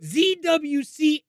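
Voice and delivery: high, loud, slow showman intro; hammed-up introduction. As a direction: announce him like a ring announcer.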